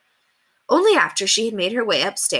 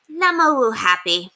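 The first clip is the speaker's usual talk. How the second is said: put-on lightly higher childish voice; 5 semitones higher, 3 dB louder than usual. A narrator's reading. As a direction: say it like a small wild child. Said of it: "Beowulf observed".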